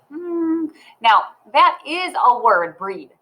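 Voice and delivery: usual speaking voice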